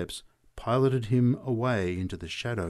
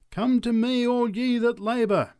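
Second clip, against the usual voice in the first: high, loud preaching call; a preacher calling to the crowd; crying out; 13 semitones higher, 6 dB louder than usual. Acted. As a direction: call the weary in from the street. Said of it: "cried the preacher".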